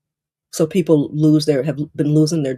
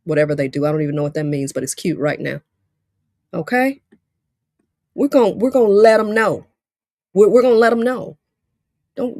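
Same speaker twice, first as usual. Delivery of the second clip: usual speaking voice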